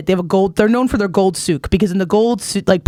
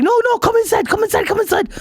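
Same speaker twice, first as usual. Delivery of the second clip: high, eager come-inside plea; imitating the vendor; 14 semitones higher than usual. Vendor pleading her inside, a high eager voice. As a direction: hustle her back into the store. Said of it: "he was like".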